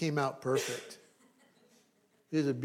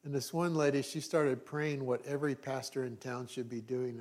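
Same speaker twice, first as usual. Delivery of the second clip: usual speaking voice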